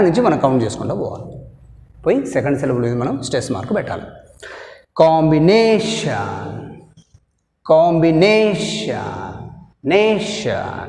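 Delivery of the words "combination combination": Combination, slow, stress on na; stress demo. Shown as a demonstration. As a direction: say Combination slowly, hitting na. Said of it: "Second syllable from the end". (నా మీద ఒత్తిడి, నెమ్మదిగా)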